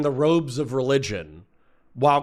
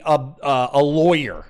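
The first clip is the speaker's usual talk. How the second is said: usual speaking voice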